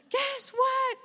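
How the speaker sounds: yelled guess what; all-caps shout. Retold or imitated. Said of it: "exclamation marks, all caps".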